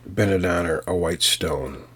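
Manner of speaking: ordinary delivery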